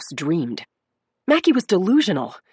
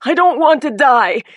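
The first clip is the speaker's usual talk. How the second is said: higher, louder fearful cry; frightened plea; 7 semitones higher, 6 dB louder than usual. A narrator's reading. Injured woman pleading with the detective. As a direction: plead with fear.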